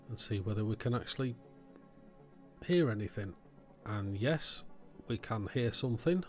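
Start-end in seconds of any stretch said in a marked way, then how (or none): none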